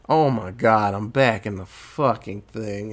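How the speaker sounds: low, dejected imitation; depressed. Tree finds herself back in the loop, imitated dejection. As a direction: sink into mock despair. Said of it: "And she's so depressed".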